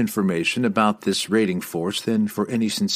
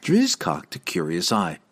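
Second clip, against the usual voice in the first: plain reading voice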